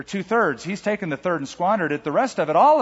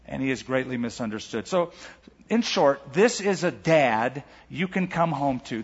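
normal talking voice

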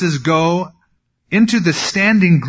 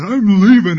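angry outburst; 5 semitones higher, 7 dB louder than usual. Retold or imitated. Samson storms out angry.

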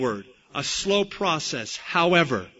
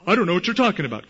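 hurried denial; 3 semitones higher, 4 dB louder, much faster than usual. Acted.

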